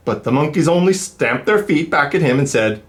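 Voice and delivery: lively, sing-song storybook reading; reading to children. A narrator's reading. Reader telling kids the peddler story, animated. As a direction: read it like to a class of little ones. Reads picture book aloud.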